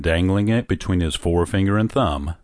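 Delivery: ordinary delivery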